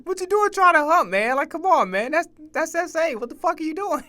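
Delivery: high, teasing mock coach voice; imitating the coach again. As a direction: ham up the coach's disbelief, pitched high. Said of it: "He said".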